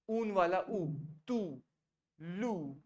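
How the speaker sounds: ऊन वाला ऊ, then तू and लू with a long ऊ; demonstrating the ऊ vowel slowly; long oo sound. Shown as a demonstration. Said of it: "ओयू की ध्वनि होती है". (ऊ की ध्वनि का प्रदर्शन)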